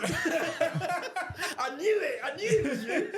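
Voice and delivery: excited fan. Retold or imitated. A fan who spots him at the mosque, high and laughing. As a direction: squeal it out like a thrilled fan who spotted him.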